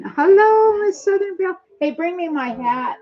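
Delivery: high, loud sing-song hello; a hammed-up cheery hello; playful high greeting. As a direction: call out a big playful hello. Greeting friend in hat on live.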